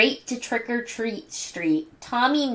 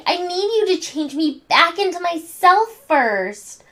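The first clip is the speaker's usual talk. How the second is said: higher anxious plea; pleading; 8 semitones higher than usual. A narrator's reading. Chucky begging Angelica to change him back, high plea. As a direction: beg her to fix him before she goes. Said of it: "cried Chucky".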